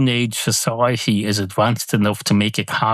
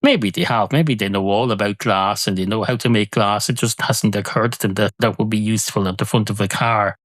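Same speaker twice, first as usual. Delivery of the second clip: ordinary talk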